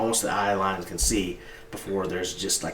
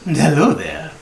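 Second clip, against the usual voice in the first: a hint of a jolly, fussing old shopkeeper; lightly voiced kindly orc gushing over customers; 7 semitones higher, 6 dB louder than usual. Shopkeeper greets another player.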